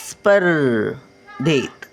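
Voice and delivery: स्पर्धेत stretched out syllable by syllable; slow syllable reading. Shown as a demonstration. Grandmother sounding out स्पर्धेत for children, slowly. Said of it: "word then slow repeat".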